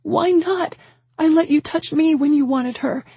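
very high, tearful outburst; anguish breaking toward sobs. A narrator's reading. Rejected woman accusing the man, anguished. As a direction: cry out each accusation, rising in desperation. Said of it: "My words faded off, becoming sobs".

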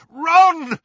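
high, loud shout of a name. A narrator's reading. Harry tries to break through to Ron, shouting. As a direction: call out at full volume. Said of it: "he shouted".

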